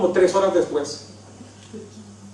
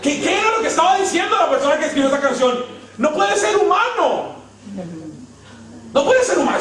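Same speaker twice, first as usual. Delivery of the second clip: much higher, incredulous comic protest; mock outrage; 10 semitones higher than usual. Preacher ridiculing the songwriter, high laughing disbelief.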